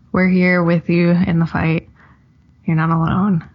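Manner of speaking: ordinary delivery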